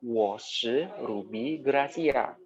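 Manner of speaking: Wo shi Ruby Gracia said very slowly and clearly; teacher modelling the answer slowly; slow example answer. Shown as a demonstration. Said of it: "Kamu jawab".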